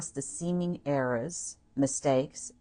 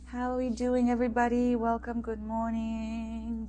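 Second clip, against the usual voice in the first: bright welcome; 8 semitones higher than usual. Acted. Bright host welcome repeated.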